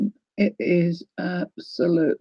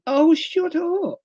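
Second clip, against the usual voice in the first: playful disbelief; 10 semitones higher, much slower than usual. Real, not acted. Disbelief at lovely fabric.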